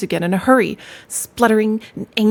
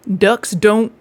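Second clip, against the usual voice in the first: cheerful rat; 7 dB louder, slower than usual. Acted. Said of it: "replied the rat cheerfully".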